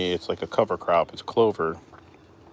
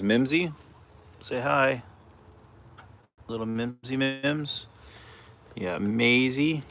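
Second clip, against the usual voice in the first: cooing pet talk; 5 semitones higher, slower than usual. Real, not acted.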